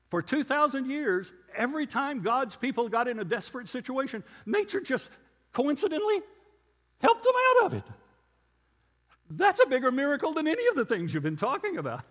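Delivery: building to a high, loud, squeaky-pitched disbelief; incredulous retort. Retold or imitated. Retelling his triumphant retort to professor.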